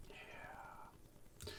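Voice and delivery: hushed whispered aside; whispering. Real, not acted. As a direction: whisper it, a bit sheepish. Embarrassed aside after goblin voice.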